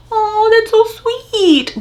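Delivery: high, slow, drawn-out aww voice; touched cooing. Retold or imitated. Reader moved by a healing father-daughter story, touched. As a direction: coo it way up high, stretching the words. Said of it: "all that combined made me like".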